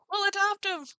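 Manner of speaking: high, loud happy cry; excited exclaim. Retold or imitated. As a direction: shout it with glee. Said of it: "it was just sort of like".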